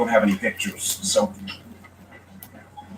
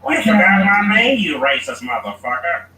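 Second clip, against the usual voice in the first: higher, louder, slow indignant character voice; put-on angry voice; 10 semitones higher, 5 dB louder than usual. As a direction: play an offended guy, pitched way up and drawn out. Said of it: "I do voices all the time".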